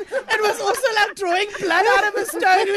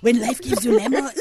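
low mock of a colleague's accent; Charlton; 9 semitones lower than usual. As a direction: mimic your co-host's put-on accent, pitched down. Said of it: "Charlton is like".

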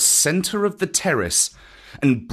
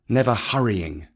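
usual speaking voice

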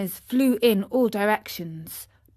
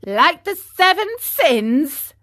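high, loud laughing retort; laugh, then toss out the slow, mocking question; 8 semitones higher, 6 dB louder, slower than usual. A narrator's reading. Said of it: "still laughing".

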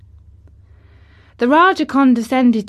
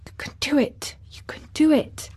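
urgent whisper, high-pitched; whispered private encouragement; to herself; 8 semitones higher than usual. A narrator's reading. Mary silently wills Colin to dig, whispering. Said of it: "said Mary to herself".

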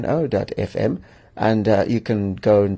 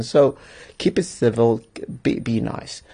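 ordinary delivery

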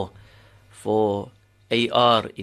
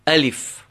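alif with a louder, higher first syllable; stress on first syllable; 3 semitones higher, 4 dB louder than usual. Shown as a demonstration. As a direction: punch the A in alif, let lif drop. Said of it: "would emphasize the first".

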